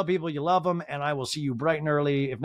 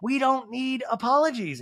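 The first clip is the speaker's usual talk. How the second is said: slow, higher mock-bitter retort; bitter, harsh; 10 semitones higher than usual. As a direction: imitate a harsh brush-off, pitched up and drawn out. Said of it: "Kyle is bitter. She's harsh here".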